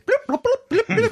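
high beeping robot voice; droid beeps. Pretend. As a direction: beep like a little droid. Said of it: "R T D two said".

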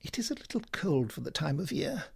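unruffled, soft small talk; mild, polite deflection; imperturbable. Acted. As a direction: chat lightly as if nothing happened. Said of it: "continued my companion imperturbably".

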